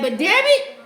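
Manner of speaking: sudden loud high frustrated cry; exasperated outburst. Real, not acted. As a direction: burst out in exasperation. Exasperated outburst at father-in-law.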